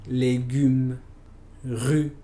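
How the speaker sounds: "légumes, rue." with a rounded French u, lips pushed forward; French u sound. Shown as a demonstration. Teacher modeling the French u for Spanish speakers, careful French. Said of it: "Por ejemplo".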